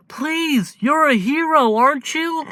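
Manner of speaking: put-on high, pleading little-boy voice; pleading child. Izuku begging the hero to save his brother, high pleading child. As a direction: beg like a desperate small child.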